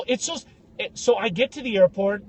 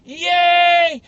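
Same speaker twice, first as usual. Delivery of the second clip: high, loud cartoonish cheering; a delighted traveller cheering over his booked ticket; mock cheer; 11 semitones higher, 8 dB louder, much slower than usual. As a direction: cheer like a kid who got his prize. Happy brown guy cheering.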